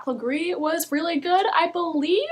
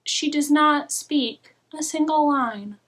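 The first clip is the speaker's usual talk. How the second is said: ordinary talk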